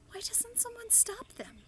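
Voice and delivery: high, breathless whisper; horrified whisper. A narrator's reading. Sophie pleads that someone stop them, high whisper. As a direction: whisper it in dismay. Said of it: "Sophie cried".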